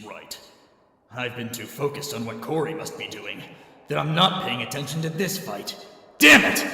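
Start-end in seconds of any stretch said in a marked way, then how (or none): 6.2-6.6 high, loud angry curse; sudden furious yell; frustrated outburst. Acted. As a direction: kick yourself for a rookie error. Frustrated at mistake.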